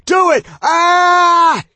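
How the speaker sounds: loud agonized shriek. A narrator's reading. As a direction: scream it out in pain. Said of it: "Josh was shrieking".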